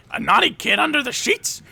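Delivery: very high, slow, leering discovery; creepy gleeful Belschnickel. Pretend. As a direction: relish catching the kid. Belschnickel finds naughty kid.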